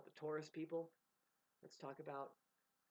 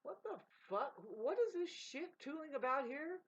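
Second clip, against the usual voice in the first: mock indignant women; 9 semitones higher, 4 dB louder than usual. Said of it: "like, going".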